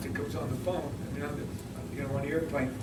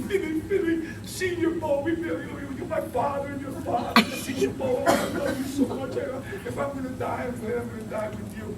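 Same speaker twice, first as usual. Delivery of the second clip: much higher, pleading mock panic; frantic teammate; 9 semitones higher, 3 dB louder than usual. Isaiah clinging to Philly on the failing plane, mock frantic. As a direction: ham up a terrified teammate's farewell.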